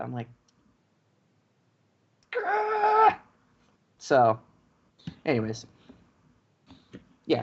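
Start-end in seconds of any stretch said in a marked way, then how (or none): 2.3-3.2 frustrated growl. Retold or imitated. Frustrated at being told no.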